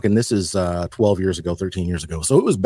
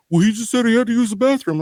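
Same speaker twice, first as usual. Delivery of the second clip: dopey coworker voice; 11 semitones higher, faster than usual. Retold or imitated. Coworker's dumb reply.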